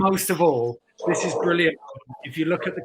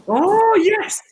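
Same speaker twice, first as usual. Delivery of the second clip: excited; 6 dB louder than usual. Real, not acted. Excited reaction to drawing.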